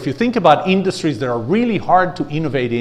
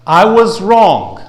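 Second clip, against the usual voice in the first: slow demo line; 3 semitones higher, 7 dB louder, much slower than usual. Shown as a demonstration. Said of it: "Second one".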